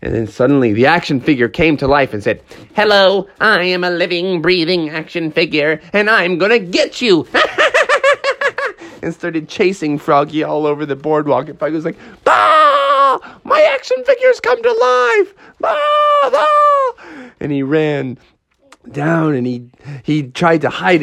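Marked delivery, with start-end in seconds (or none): 2.8-7.4 higher-pitched villain toy voice, ending in a cackle; menacing toy come alive. Acted. Action figure come to life threatening Froggy, gleeful menace. 12.3-16.9 very high hammed-up shriek of terror; panicked screaming. Froggy fleeing his living action figure, panicked shrieks.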